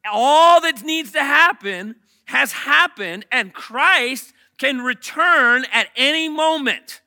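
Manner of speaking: loud, slow and deliberate; emphatic preaching. Real, not acted. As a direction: drive the point home slowly. Preacher stresses key point.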